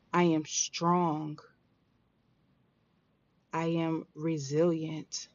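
calm, deliberate affirmations to repeat; guided affirmations. Acted. Leads listeners in affirmations.

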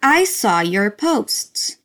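the full phrase joined up but slowed right down; slow joined-up model phrase; slow whole phrase. Shown as a demonstration. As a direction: put the words back together slowly.

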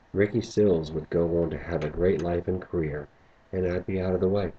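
ordinary delivery, nothing marked

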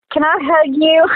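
a hint of a chirpy church lady offering a hug; sweet stranger. Stranger approaches crying speaker.

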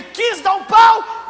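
very high, loud, stretched-out shout; hammed-up yell. Preacher acting the woman's midday near-breakdown, mock-frantic shout. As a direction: burst out as if about to break down. Acts tears nearly breaking out.

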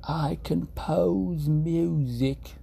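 England voice. Shown as a demonstration. Streamer showing chat the right way, posh English accent. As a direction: stretch it out grandly like an English composer. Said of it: "You have to say".

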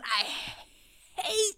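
normal talking voice

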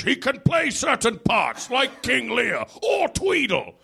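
high, slow theatrical British boom; British stage voice. Retold or imitated. Old British actor on his roles, slow mock-theatrical. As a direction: intone it like a venerable actor.

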